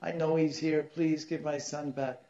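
high, pleading voice of a weeping mother; mock-tearful begging; imitated crying plea. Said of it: "crying, crying".

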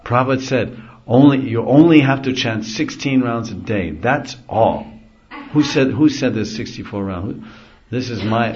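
loud, high, certain preacher voice; bold and convinced. Retold or imitated. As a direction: declare it like a confident preacher. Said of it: "They very convincingly and boldly say".

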